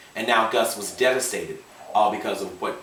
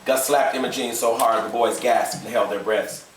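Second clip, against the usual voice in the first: usual speaking voice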